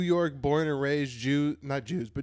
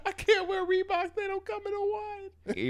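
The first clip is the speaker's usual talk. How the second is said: very high, whining put-on voice; mock complaint; 3 dB quieter than usual. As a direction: whine it in a squeaky, mock-tearful voice. Acting a whiny wide-footed person.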